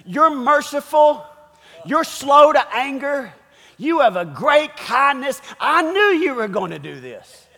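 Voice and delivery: hammed-up indignant grievance; mad at God. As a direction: throw each reason back as an accusation. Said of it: "Here's a guy mad".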